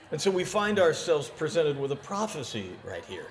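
ordinary talk

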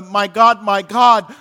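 loud cry of anguish to God. Retold or imitated. Believer in a dark moment crying out to God, loud. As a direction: call out to heaven in pain.

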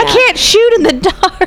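high, slow whiny victim voice; mock scared voice. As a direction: whine like a helpless victim. Mocking movie character.

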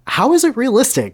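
very high, baffled question; uninformed inquiry. Acted. Voldemort questions the Reverend's verdict, high and clueless. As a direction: ask it high, in clueless disbelief. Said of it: "Voldemort inquired uninformedly".

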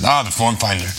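put-on a weak stab at a Norwegian accent; bad Norwegian accent. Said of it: "That was a terrible Norwegian accent".

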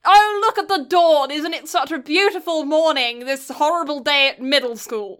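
lightly sung-out mock-posh rapture; mock flowery greeting. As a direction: parody an overly elegant morning greeting. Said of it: "what do you want me to say?".

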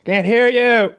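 high, loud sing-song taunt; hammed-up high-pitched dismissal; mock defiance. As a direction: brush the cop off playfully. Mocking defiance of cop.

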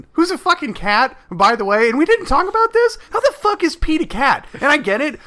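climbing into incredulous outrage; excited rant. Real, not acted. Podcaster ranting to cohost about Pete being a cat, rising outrage.

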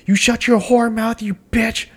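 high-pitched mock scolding; angry girl. The girl telling off Marie the bartender, imitated high and angry. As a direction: imitate her snapping in a high voice.

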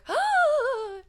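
hammed-up thrilled gasp; excited gasp. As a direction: gasp in mock delight. Acting Cleo's delighted gasp.

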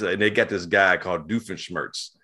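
usual speaking voice

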